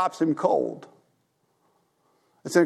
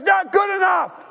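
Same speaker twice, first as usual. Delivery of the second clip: high, sharp shout of refusal; harsh refusal; 12 semitones higher than usual. Retold or imitated. Forgiven servant refusing his debtor, a high sharp shout. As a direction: cut him off hard.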